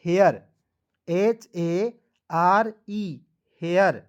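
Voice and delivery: Hair said firmly and loud, एचएआरई spelled out slowly; teacher drilling the class on hair, punched out loud; emphatic model word. Shown as a demonstration. Teacher to students, drilling the word loudly and clearly. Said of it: "इसका उच्चारण एयर के रूप में करेंगे".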